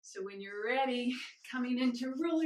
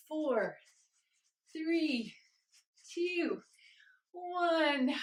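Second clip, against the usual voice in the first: building countdown; 6 semitones higher, 4 dB louder, much slower than usual. Real, not acted. Counting down the final round.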